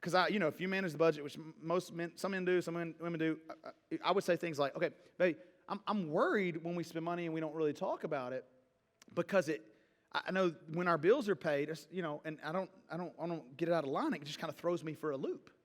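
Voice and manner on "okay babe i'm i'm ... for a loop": softer, lower, reassuring husband voice; gentle feelings talk. Shown as a demonstration. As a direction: calmly share a worry with your wife. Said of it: "now I'm expressing my feelings".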